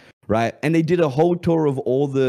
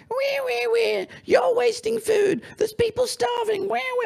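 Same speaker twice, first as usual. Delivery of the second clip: much higher, squeaky whining wee wee voice; mocking viewers who whine about wasting food in a much higher squeaky voice; whiny complainer; slower than usual. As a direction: squeal like a petty scold. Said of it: "people coming up to me being like".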